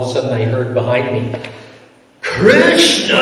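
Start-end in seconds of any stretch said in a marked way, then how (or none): 2.2-3.1 high, loud demonic yell. Retold or imitated. Angry donor shouts from behind on sankirtan, demonic mock yell. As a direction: bellow it like a demon. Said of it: "Like this really demonic voice".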